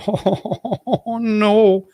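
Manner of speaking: high, whiny mock apology; a hint of a timid sorry voice; mock apologetic voice. Mocking apologetic vlogger.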